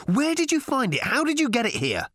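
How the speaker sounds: high-pitched, frantic demands; snapped questions. A narrator's reading. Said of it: "snapped Arthur".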